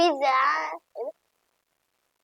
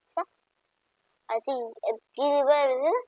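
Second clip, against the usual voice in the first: normal talking voice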